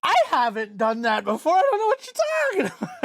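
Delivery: high, loud mock-outraged protest; indignant outburst. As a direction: cry out in exaggerated disbelief, voice shooting up. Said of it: "I was like".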